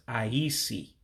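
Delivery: "I E C" said with a low, falling tone. Shown as a demonstration. Teacher shows the low tone that means ice in Igbo. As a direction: drop the voice on "I E C". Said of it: "saying it real low like I am".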